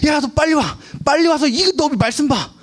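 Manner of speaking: put-on high, hurried mother calling her child; urgent parent call. Parent urgently calling child to the sermon, pitched high. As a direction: hurry the kid over, voice up high. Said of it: "자녀를 다급하게 불러요".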